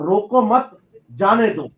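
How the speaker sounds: रोको मत run together, a break, then जाने दो; pause after मत. Shown as a demonstration. Explaining how a comma flips meaning, pause after मत in रोको मत जाने दो. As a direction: put the comma after मत, so it means let go. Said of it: "comma इधर से उधर किया".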